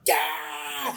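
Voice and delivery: high shouted crowd yeah; crowd cheer. Retold or imitated. Club crowd cheering the drop, high shouted yeah.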